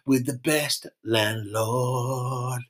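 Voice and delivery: hammy landlord welcome; character voice. Acted. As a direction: announce yourself like a proud landlord. Comic pub character intro.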